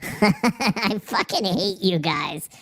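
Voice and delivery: ordinary talk, nothing marked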